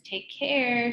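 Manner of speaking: a hint of a boy signing off; Calvin. A narrator's reading. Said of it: "said Calvin's voice".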